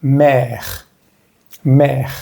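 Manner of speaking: Mère as "mehr", final e silent; careful French mère ending on the r. Shown as a demonstration. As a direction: say Mère slowly with open è, French r. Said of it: "no la vamos a pronunciar".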